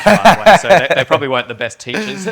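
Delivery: laughing through a teasing joke. Real, not acted. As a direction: say it while cracking up, loud. Joking that Kiwis teach bad English.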